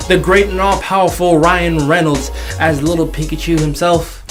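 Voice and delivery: ordinary talk, nothing marked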